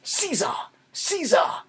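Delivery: high-pitched dramatic call; hammed-up summons, thrown high; old beggar. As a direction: cry out the name like a stage beggar. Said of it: "First you're gonna do this part".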